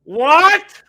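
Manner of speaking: loud, high startled shout; shocked. Real, not acted. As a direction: blurt it out in shock. Shocked reaction to name on screen.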